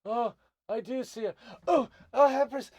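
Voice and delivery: high gnome voice rising to a loud gasp; awestruck gnome. Acted. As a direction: exclaim in shock on recognizing the Empress. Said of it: "finally looks at you and goes".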